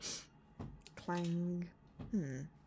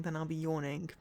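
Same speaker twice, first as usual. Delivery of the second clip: ordinary delivery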